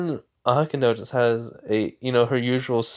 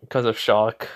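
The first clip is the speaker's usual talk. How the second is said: ordinary delivery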